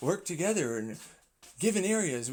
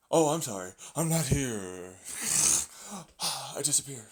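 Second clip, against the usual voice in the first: normal talking voice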